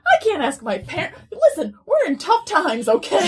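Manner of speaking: loud, high-pitched indignant protest; hammed-up teenage outrage with laughter underneath; mock-mad. Broke teen explaining tough times to rich crush, mock-mad. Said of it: "I was, like, mad".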